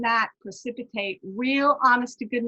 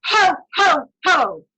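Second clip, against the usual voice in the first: loud, higher Ho, ho from the diaphragm; belly laugh ho ho ho; 5 semitones higher, 9 dB louder, slower than usual. Shown as a demonstration. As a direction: boom it out from the belly, like Santa. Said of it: "Breathe in".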